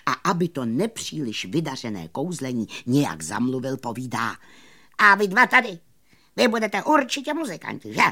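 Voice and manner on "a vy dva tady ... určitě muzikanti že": high, quick, loud patter; showman magician. Acted. Magician buttering up two musicians, high quick patter. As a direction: address them briskly and brightly.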